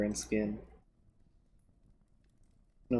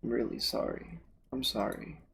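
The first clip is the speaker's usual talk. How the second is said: quieter, tearful apology; tearful, fading; 4 dB quieter than usual. Acted. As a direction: fade as tears take over. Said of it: "Bird's voice grows fainter".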